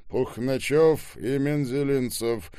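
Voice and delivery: loud, slow and deliberate; loud announcement. A narrator's reading. Ребров announcing two surnames over the yelling, loudly. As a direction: call the surnames out loudly and slowly. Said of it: "громко произнёс Ребров".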